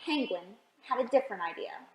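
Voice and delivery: ordinary delivery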